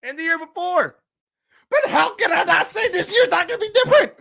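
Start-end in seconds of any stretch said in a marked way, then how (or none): 1.7-4.1 laughing. Real, not acted. Fan ranting, laughing.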